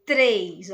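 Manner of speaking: "três" with a tapped R, low and drawn out; R batido. Shown as a demonstration. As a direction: demonstrate the tongue-tap R in "três". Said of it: "ele bate num dente". ("três" com R batido, bem articulado)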